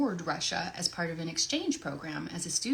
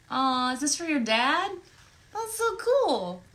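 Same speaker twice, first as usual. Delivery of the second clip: excited; 9 semitones higher, 6 dB louder, slower than usual. Real, not acted. Gushing over a fan request.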